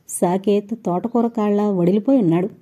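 usual speaking voice

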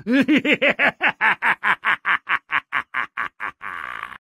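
long villainous cackle; drawn-out sinister host laugh; evil cackle. Acted. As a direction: cackle like a gleeful ghoul. Host's sign-off cackle.

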